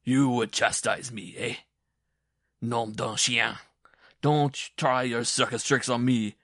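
loud, blustering mockery; bully the little man at high volume; roaring taunt. A narrator's reading. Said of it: "he roared".

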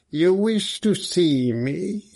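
harsh but civil, drawn-out question; harsh, metallic voice. A narrator's reading. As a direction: ask it slowly in a harsh, metallic voice. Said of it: "He had a harsh, metallic voice".

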